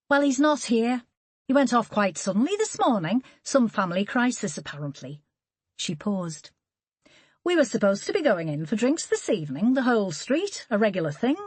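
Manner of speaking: put-on neighbour explaining in a posh Scouse accent, a touch disappointed. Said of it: "Holly recognised the accent as posh Scouse".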